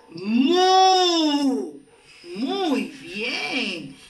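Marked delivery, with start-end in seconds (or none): 0.1-1.7 "mu" held long for learners; one sustained, deliberate "mu"; sílaba modelo. Shown as a demonstration. ("mu" sostenido, como modelo)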